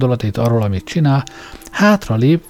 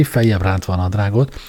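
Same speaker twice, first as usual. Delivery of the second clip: ordinary delivery, nothing marked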